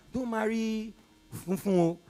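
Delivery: ordinary talk, nothing marked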